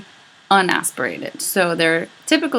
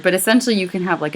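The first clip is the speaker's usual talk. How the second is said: ordinary talk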